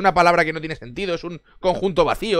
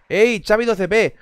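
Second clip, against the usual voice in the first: high, slow, drawn-out call to a new sub; excited shout-out; 7 semitones higher, 4 dB louder, slower than usual. Real, not acted. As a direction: hail the subscriber cheerfully. Greeting a new subscriber.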